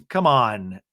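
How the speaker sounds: ordinary talk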